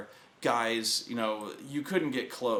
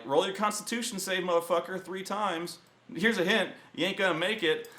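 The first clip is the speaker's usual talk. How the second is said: higher-pitched, needling taunt; mock taunt; 7 semitones higher than usual. Game master tells player to roll a save, taunting. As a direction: rub it in, voice pitched up.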